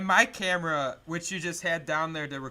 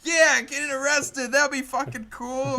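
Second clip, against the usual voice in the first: mock teen excitement; 7 semitones higher, 6 dB louder than usual. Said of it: "suburbanites of Boston are like".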